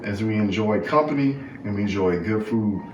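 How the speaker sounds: soft, reverent grace before the meal; bow your head and bless the food softly; mealtime prayer. Real, not acted.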